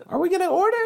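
high squeaky voice through laughter; laughing squeaky voice. Acted. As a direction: giggle out a silly high question.

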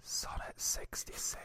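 breathy close-mic whisper; creepy whisper. Pretend. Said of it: "Anything becomes creepy if you whisper it".

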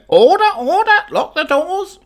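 very high, loud squawk; high parrot squawk. Acted. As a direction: shriek it like a caged parrot. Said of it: "this parrot in my box is going".

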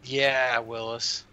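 usual speaking voice

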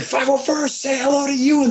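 high-pitched reenacted rallying shout; calling out. Actor rallies the legion to greet Ewan, high mock shout. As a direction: call the troops in a high, strained voice.

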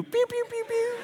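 high, playful pew-pew noises; finger-gun sound effects; mock gunshots. Pastor joking to the cashier, playful pew-pew gunshots. Imitating gunfire as a joke.

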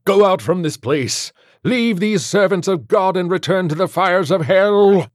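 high, loud banishing shout; forceful priestly command; commanding exorcism. Acted. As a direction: drive the demon out at full force.

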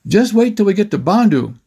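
acted mild yell; calling out. A narrator's reading. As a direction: call back to your brother. Still yelling over the motor.

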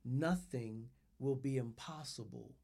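low, soft and deliberate; soft affirmation. Shown as a demonstration. Pastor modelling a soft spoken affirmation. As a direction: speak it quietly over your own life. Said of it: "I want you to say it, speak it over your life".

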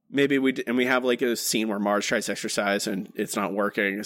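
ordinary delivery

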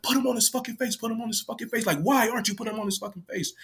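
high mock yell without the volume; imitating yelling. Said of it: "like, yelling, like".

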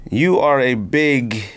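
ordinary talk

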